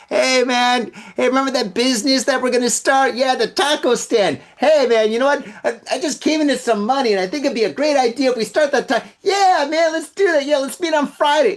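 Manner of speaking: high, rushed buddy pitch; eager drunk caller. Acted. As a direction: gush a big plan to an old pal. Acted eager drunk business call.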